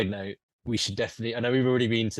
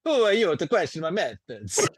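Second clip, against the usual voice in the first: higher, louder, hammed-up Italian; outlandish Italian accent; 6 semitones higher, 5 dB louder than usual. Game master obliges player's request, outlandish Italian accent. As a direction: overdo a sing-song Italian accent. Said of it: "you could do an outlandish Italian accent".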